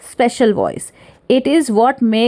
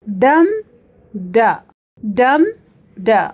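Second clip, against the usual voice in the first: strong raised डम, dropped short ड, with pauses between; high then low; slower than usual. Shown as a demonstration. Teacher tapping out trochee, strong डम falling to weak ड. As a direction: punch डम up, let ड fall away. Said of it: "पहला है high syllables, फिर लो".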